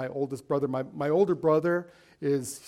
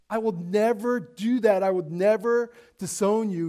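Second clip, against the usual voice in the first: higher-pitched, insistent vow; emphatic, cocksure promise; self-confident vow; 5 semitones higher than usual. Acted. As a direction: protest with total self-assurance, pitch raised. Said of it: "despite his self-confidence".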